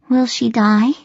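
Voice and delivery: put-on small, high child's question; curious. A narrator's reading. Little boy asks sister about their hurt mother, curious. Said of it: "he sounded more curious than anything".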